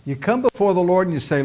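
ordinary talk

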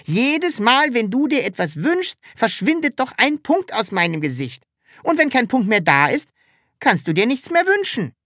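high-pitched, lively creature voice; excited, insistent little character; excited Sams, explaining. Acted.

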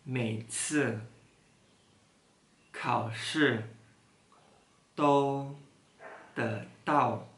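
word by word, long pauses between; slow, one word at a time; reading aloud haltingly. Shown as a demonstration. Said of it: "Coba baca pelan-pelan".

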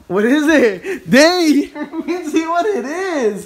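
laughing as he asks, slow and loud. Real, not acted. Dad laughs at kid's reaction.

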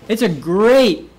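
great slowed and punched; stressed word. Shown as a demonstration. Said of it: "it's a great contrasting word".

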